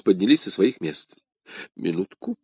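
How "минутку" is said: usual speaking voice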